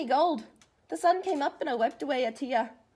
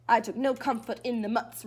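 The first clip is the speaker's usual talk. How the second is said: ordinary delivery, nothing marked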